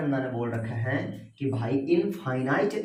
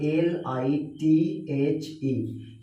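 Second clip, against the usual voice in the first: letter by letter; much slower than usual. Shown as a demonstration. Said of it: "पढ़ेंगे मेरे साथ".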